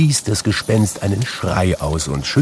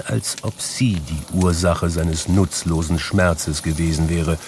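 usual speaking voice

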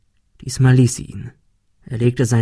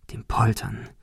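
hushed, ominous whisper; ghostly whispered aside; eerie whisper; 8 dB quieter than usual. A narrator's reading. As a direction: breathe it out like a secret. Eerie emphasis on the noise.

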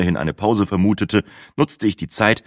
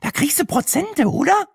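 extrem hohe Figurenstimme; neugierige Brenda. Acted. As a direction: ganz hoch und neugierig nachfragen. Brendas Figurenstimme fragt weiter. (hohe, verstellte Frage)

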